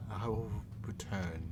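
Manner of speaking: very soft, ominous parting threat; departing spirit voice. Acted. Presence leaving the player's mind, soft ominous promise. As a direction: quietly fade out as a sinister presence. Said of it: "feel, like, the presence leave".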